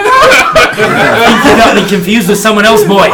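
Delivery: loud, slow, laughing through the line; laughing dwarf voice. Acted. Dwarf retorts to the half-orc, laughing loudly. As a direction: boom it out while cracking up.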